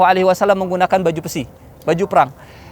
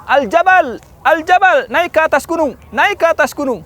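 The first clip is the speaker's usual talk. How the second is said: high, loud rallying call; urgent battle command; 12 semitones higher, 4 dB louder than usual. Acted. Prophet ordering companions under attack, urgent high call. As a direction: call the troops up the mountain.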